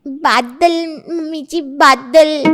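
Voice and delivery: बादल said as a babyish attempt at bazaar; lisped, insistent बादल; lisped mispronunciation. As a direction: insist on बादल, high and emphatic, with a childish lisp. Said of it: "वो बाजारी कह रही है".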